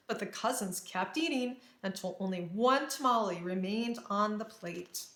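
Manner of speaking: ordinary delivery, nothing marked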